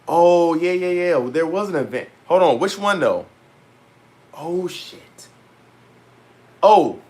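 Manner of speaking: jolted awake by the reminder, voice jumping up; sudden excited remembering. Real, not acted.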